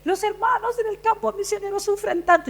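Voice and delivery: high, plaintive mock appeal; pitying pastor. Pastor asking an offering for missionaries, mock-pitying. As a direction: imitate an overwrought plea for pity. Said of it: "el pastor nos pasa al frente para pedir una ofrenda".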